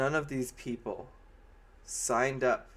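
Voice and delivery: normal talking voice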